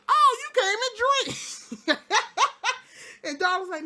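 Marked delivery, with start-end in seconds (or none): 0.1-2.2 high, loud, giddy hostess voice; mock Charlie pouring wine, high and loud; tipsy party host. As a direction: play her giddy and tipsy. Charlie tipsy offering wine, laughing.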